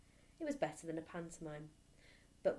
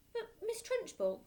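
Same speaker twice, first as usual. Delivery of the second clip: much higher, whining little protest; small boy. Acted. Boy protesting to the headmistress, high and whining. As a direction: squeak it out like a frightened boy.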